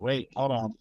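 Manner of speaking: ordinary delivery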